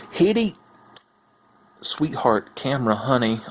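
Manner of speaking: usual speaking voice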